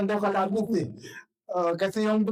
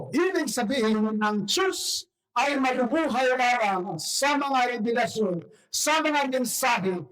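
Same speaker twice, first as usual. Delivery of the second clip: preaching; 5 semitones higher, slower than usual. Acted. Pastor preaching to the congregation, forceful and slow. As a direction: drive the point home from the pulpit.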